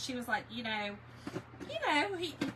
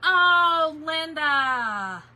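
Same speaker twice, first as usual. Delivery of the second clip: excited greeting; 5 semitones higher, 14 dB louder, much slower than usual. Real, not acted. Greeting a viewer by name live.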